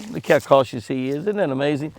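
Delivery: ordinary talk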